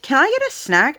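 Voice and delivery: a mother mimicking her small daughter, pitched well up. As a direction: ask sweetly like a four-year-old.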